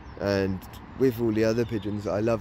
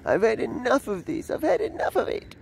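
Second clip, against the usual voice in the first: high pigeon voice; 11 semitones higher than usual. Retold or imitated. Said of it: "He's saying".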